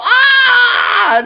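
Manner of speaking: very high shriek of fright; high scream. Retold or imitated. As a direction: shriek as the ship drops. Pedro voiced screaming.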